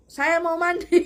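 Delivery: calling out loud with a laugh; called out. Retold or imitated. Captive calling to the guards, shouted with a laugh. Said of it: "saya berteriak".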